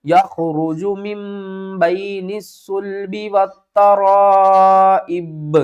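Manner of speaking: very slow melodic tajweed chant; slow melodic Quran recitation; Quranic recitation. Shown as a demonstration. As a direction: recite it slowly, drawing out vowels. Teacher recites full verse.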